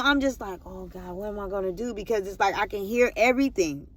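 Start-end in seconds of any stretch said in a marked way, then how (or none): 0.6-1.9 soft, under-the-breath worry; retold helpless thought. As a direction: drop the volume, as if thinking aloud.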